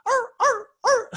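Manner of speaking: squeaky arr arr arr; dog barking. Retold or imitated. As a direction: yap like a small dog below you. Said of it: "And he's going".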